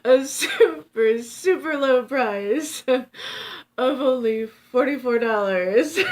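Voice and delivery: slow, high, loud sales pitch; hammed-up TV pitchman; infomercial voice. Shown as a demonstration. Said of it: "It sounded like an infomercial".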